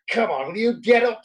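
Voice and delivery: impatient gaffer shouting get up; mock coach yell. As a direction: bark it down at him like a hard-man manager. Manager yelling at floored player.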